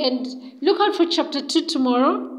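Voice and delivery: plain reading voice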